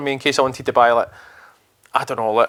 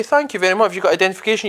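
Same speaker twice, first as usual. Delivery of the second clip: mock sweet cashier; 6 semitones higher, faster than usual.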